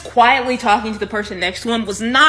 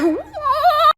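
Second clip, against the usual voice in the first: stunned disbelief. Retold or imitated. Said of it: "we were like".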